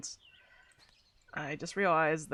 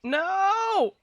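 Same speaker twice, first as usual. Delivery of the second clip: dismayed cry; 13 semitones higher, 5 dB louder than usual. Real, not acted. Inventory full, rock lost.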